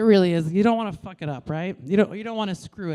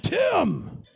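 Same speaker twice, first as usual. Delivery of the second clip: much higher, louder wrestler shout; Macho Man Randy Savage; 12 semitones higher, 5 dB louder, slower than usual. Retold or imitated. Mid-push mother yells at the med student, wrestler shout. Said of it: "sounding for all the world like Macho Man Randy Savage".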